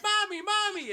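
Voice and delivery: high, loud mock child's cry; hammed-up frightened little boy; Daniel. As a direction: wail for mom in falsetto. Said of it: "he's like".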